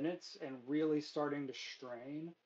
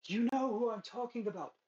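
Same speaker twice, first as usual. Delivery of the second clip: much higher-pitched character voice; high, put-on lady's voice; scheming woman's voice; 9 semitones higher than usual. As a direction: pitch it right up for the villainess. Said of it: "And she says".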